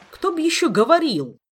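high, very loud outburst; indignant exclamation. A narrator's reading. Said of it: "негодующе воскликнул он".

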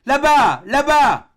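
high, very loud shout; inhuman cry. A narrator's reading. Doctor spots something on the horizon, inhuman cry. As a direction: cry out in a high, no longer human voice. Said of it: "d'une voix qui n'avait plus rien d'humain".

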